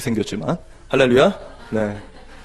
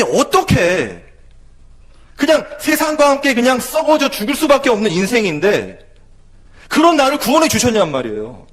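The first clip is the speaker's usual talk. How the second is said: louder, higher pleading sermon delivery; impassioned preaching; 7 semitones higher, 3 dB louder than usual. Acted. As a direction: preach it with rising urgency.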